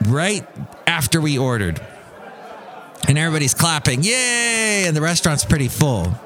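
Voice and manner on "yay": high, drawn-out mock cheer. Diners clapping as power goes out, mock cheer.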